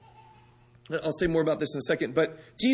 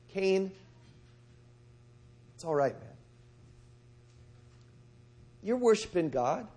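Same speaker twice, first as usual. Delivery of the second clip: gentle reassurance; 3 dB quieter than usual. Acted. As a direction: ease him down quietly, like a caring brother. Abel gently reassures his brother.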